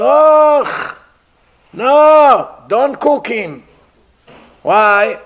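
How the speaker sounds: high, loud squealing alarm; disgusted cannibals. Acted. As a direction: recoil in horror from the bleeding prisoner. Cannibals recoil from bleeding king.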